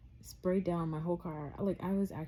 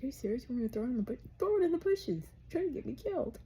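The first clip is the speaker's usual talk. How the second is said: much higher mock disbelief, peaking on bushes; incredulous boyfriend; 8 semitones higher, 4 dB louder, much faster than usual. Boyfriend refusing to toss the visor, mock incredulous and high. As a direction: throw his outrage back at her, high pitched. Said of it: "he was like".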